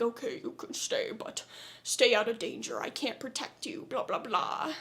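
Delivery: hushed offhand parody of a protective hero; Peter quote. Retold or imitated. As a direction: mumble his warning dismissively under your breath. Voicing Peter warning Rose.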